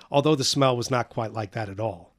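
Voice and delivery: usual speaking voice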